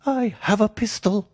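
very high, thin frightened man; reedy, quivering voice. A narrator's reading. Frightened patient warning strangers from the dark, reedy and quivering. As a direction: pipe it out high and quavering. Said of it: "came a reedy, quivering voice".

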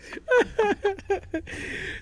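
high burst of laughter. Real, not acted. Laughing at the hashtag mixup.

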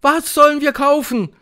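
high, loud, eager cry; called-out question. A narrator's reading. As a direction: shout the question to your leader. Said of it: "riefen sie". (rufend, hoch und laut)

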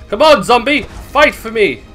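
normal talking voice

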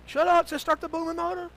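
high, loud bellow in a quarrel; angry yelling. Retold or imitated. Arguing boatman to the other, angry yell. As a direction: yell it over a roaring argument.

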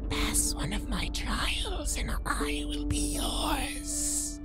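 raspy whispered hag voice; old hag. A narrator's reading. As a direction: rasp it out like a cave beast. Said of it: "in a raspy voice".

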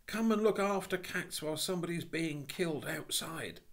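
high, loud, indignant schoolboy; goading the policeman into action; urgent boy. Acted. Boy taunts policeman about murder.

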